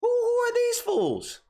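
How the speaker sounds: very high, incredulous shriek, rising to full volume; mock shocked outrage. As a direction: squeal at the fools pushing to the front. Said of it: "all of us are like".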